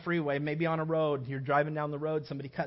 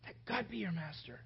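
much softer, gentle repeat; 10 dB quieter than usual. Real, not acted. Preacher repeating the appeal to the congregation, much softer. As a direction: let it settle quietly.

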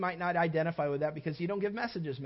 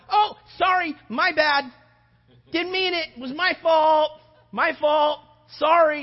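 high, loud frantic sorry; loud apology; 9 semitones higher, 11 dB louder, slower than usual. Retold or imitated. Driver apologizing to the honking car, loud and high. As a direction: call out apologies through the window.

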